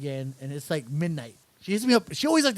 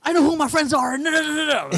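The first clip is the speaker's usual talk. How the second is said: mock indignant retort; 10 semitones higher, 5 dB louder than usual. Mocks friend's indignant text.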